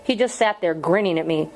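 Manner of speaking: ordinary delivery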